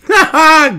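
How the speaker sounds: a sharp, high, loud burst of scornful laughter; mocking laugh. Real, not acted. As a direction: laugh out loud in disbelief at the news. Mocking the timing of the leak.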